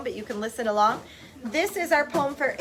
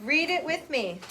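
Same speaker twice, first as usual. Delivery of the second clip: ordinary delivery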